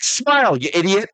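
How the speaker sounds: high, mocking taunt; Rock impression. Friend imitating The Rock taunting interviewer, high mocking voice. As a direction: jeer at the interviewer in a high mock voice. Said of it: "he's like".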